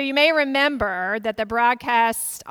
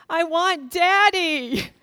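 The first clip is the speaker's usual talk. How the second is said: put-on high, slow child's whimper; a little girl asking for her father; small child's plea; 6 semitones higher, 6 dB louder, slower than usual. High slow child voice, daughter to mother at church. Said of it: "And she said".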